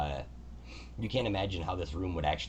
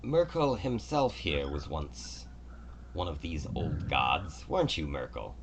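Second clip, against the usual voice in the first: god Kelemvor; slower than usual. Acted. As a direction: speak as a gracious, orderly god. Kelemvor teases the old man.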